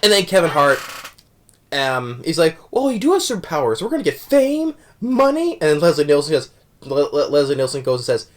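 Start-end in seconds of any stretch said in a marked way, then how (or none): 2.7-5.5 high-pitched imitated thrill; mock excitement. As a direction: act out getting carried away, pitched way up. Acting out Kevin Hart's excited reaction.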